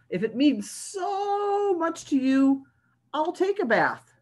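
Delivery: slow, high pigeon caving in; reluctant pigeon. Acted. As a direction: give in with a heavy, put-upon sigh.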